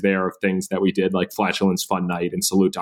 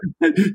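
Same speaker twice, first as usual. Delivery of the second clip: quick high laugh at the question; sheepish giggle; embarrassed laugh; 12 semitones higher than usual. Real, not acted. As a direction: laugh it off, caught out. Asked about dating.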